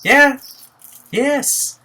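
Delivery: high, eager yeah then yes; emphatic yes. Retold or imitated. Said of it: "I'm like".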